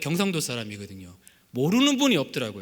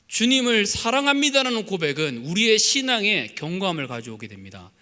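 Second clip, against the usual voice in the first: ordinary talk, nothing marked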